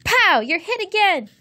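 high-pitched gleeful war-game cry; loud swooping POW, then high taunt; playground yell. A narrator's reading. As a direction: yell it from behind cover. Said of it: "Asher yelled from behind the tree".

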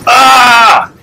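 loud high shocked shout; sudden cry of alarm; startled yelp. Real, not acted. Jolted by the crash, loud startled shout.

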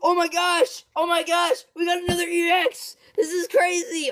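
high, loud thrilled shouts; yelling over a great pull; excited shouting. Real, not acted. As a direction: shout with disbelieving joy. Pulls another EX card.

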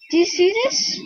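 high, loud, astonished question; amazed question. Real, not acted. Player asks viewers to look at the dragon, amazed. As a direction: ask it loudly in high-pitched disbelief. Showing off surprising dragon sighting.

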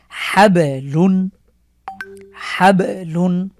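হাবলুন said very slowly with the ব strongly bounced; strong qalqalah. Shown as a demonstration. Teacher reciting হাবলুন slowly for students to copy. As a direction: stretch হাবলুন and pop the ব. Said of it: "বেশি করেই পড়ার চেষ্টা করব".

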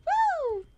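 high, short woo; playful high-pitched woo; streaker's whoop. Acted. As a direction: whoop like a toddler running free. Acting streaking toddler.

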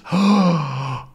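sharp audible gasp; surprised gasp. Acted. Crew seeing Calculus appear, gasp. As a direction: gasp in shock. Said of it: "they're all very surprised".

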